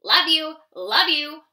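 loud mock quack; quacking uncle. A narrator's reading. Uncle duck quacking goodnight to ducklings, loud quack. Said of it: "Uncle quacked".

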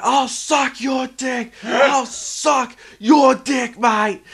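put-on high, loud, slow drunken shout; drunk yelling. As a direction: bellow it like a hammered bloke. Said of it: "And the guy's like".

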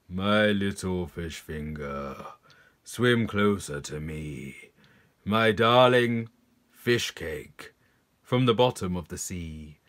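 low, slow, coaxing voice; luring predator fish. Acted. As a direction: lure the little fish in, low and silky. Predator coaxes its prey.

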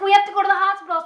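panicked dash to the hospital; panicked rush. Retold or imitated. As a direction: blurt it out in a panic.

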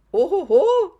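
high, loud joyful oh ho ho; delighted exclamation. Real, not acted. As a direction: burst out in delight. Delighted at chat answers.

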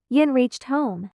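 usual speaking voice

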